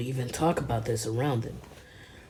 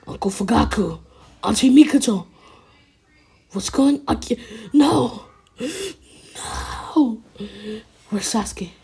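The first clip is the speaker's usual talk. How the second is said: high, rising cries of disbelief; acted horrified outcry; distraught calling; 6 semitones higher, 5 dB louder than usual. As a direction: call out higher and louder as dread builds. Acted discovery of slaughtered family.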